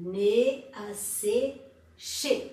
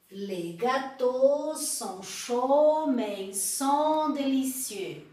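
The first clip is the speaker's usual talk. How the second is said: slow, careful French for repeating; slow model sentence; much slower than usual. Shown as a demonstration. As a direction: lay out each word slowly. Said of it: "Então, répétez, s'il vous plaît". (devagar, para repetir)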